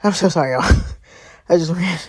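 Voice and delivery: really winded from running the stairs; breathless after a quick stair run; out of breath. Real, not acted. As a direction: apologize between breaths and a little laugh. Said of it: "I'm out of breath".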